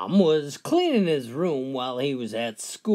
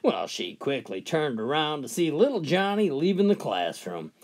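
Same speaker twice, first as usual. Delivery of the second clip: ordinary delivery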